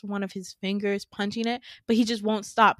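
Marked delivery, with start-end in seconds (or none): none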